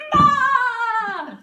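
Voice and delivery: loud roaring bah, tongue out; lion roar. Shown as a demonstration. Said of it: "at each other".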